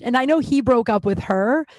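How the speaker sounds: normal talking voice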